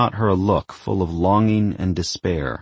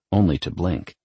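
usual speaking voice

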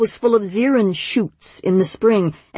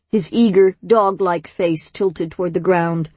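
ordinary delivery, nothing marked

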